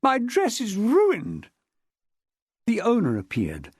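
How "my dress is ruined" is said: higher, slow outraged wail; shrill-voiced outrage, higher pitch; cried out. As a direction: cry out over the ruined dress. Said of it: "Miss Chilmark cried".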